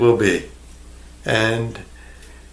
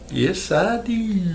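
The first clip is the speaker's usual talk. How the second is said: high retort; 8 semitones higher, 4 dB quieter, slower than usual. Acted. Answering imagined viewer.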